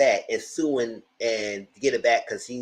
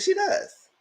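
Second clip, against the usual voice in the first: squeaky. Retold or imitated. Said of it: "I said".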